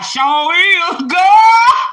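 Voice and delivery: high, loud mock holler from the pews; church crowd hollering. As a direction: shout it like the whole church. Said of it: "the whole church bust out laughing".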